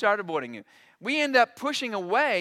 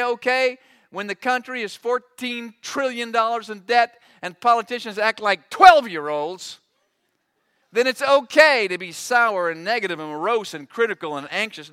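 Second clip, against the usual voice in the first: louder mock voice of God; hammed-up, louder God granting permission; mock permissive God; 3 semitones higher, 8 dB louder than usual. Preacher voices a permissive God, louder.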